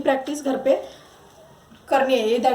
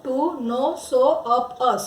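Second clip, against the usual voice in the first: very slow, word by word; drawn-out teacher read; slow drill reading; much slower than usual. Shown as a demonstration. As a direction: model it slowly for the class. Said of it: "इसकी practice करनी है".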